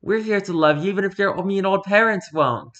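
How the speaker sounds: normal talking voice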